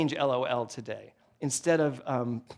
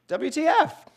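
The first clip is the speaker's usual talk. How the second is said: cheerful retold exclamation; 12 semitones higher, 4 dB louder than usual. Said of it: "I said".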